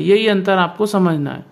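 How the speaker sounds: ordinary talk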